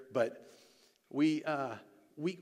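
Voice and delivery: usual speaking voice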